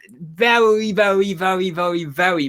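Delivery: VERY stressed over and over, starting high and stepping down; emphatic repeated very. Real, not acted. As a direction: lean hard on every "very". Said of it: "it did warrant that amount of".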